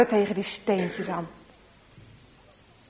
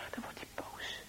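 whisper; 21 dB quieter than usual. A narrator's reading. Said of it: "repeated, whispered second take".

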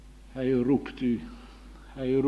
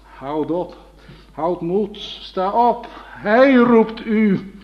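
loud and high, with a slow drawn-out ending; ringing summons; 7 semitones higher, 9 dB louder, slower than usual. Acted. Preacher passing on the call to the congregation, loud and slow. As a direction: call out like a herald to the crowd. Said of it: "om de gemeente op te roepen".

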